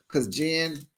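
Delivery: ordinary delivery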